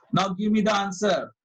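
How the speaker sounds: ordinary talk, nothing marked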